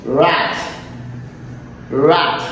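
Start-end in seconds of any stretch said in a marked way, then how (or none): none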